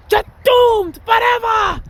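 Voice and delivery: very high, slow, drawn-out witch shriek; slow, shrieking witch, loud; shouted witch curse. Retold or imitated. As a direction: stretch the curse out and yell it like a movie witch. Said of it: "I remember that movie, Witches".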